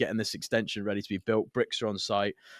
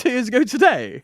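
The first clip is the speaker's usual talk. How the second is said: high, loud disbelief; can't believe it's been that long; surprised; 13 semitones higher, 10 dB louder than usual. Real, not acted. Realising the podcast anniversary, high surprised.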